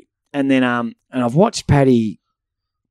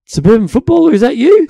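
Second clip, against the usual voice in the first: higher and louder answer; mock excited coach; 12 semitones higher, 7 dB louder than usual. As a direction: echo the greeting with delight. Coach greets him back.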